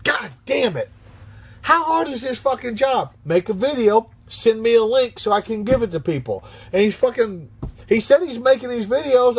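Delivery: high-pitched, worked-up complaining; exasperated rant. Real, not acted. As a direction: rant about the worker's failure, building to the end.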